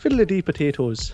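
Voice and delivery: mock Irish accent. Shown as a demonstration. Asked for an Irish accent, a jokey Irish put-on. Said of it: "an Irish accent".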